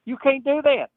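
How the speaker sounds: a high, indignant mock protest; shocked protest. Shocked at product discontinued.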